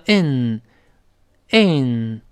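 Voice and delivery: in, in, in held slowly; in sound demo. Shown as a demonstration. Teacher stretching the ending of complain. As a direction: glide into in slowly. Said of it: "再滑到in".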